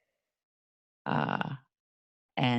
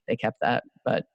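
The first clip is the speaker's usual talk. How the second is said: ordinary talk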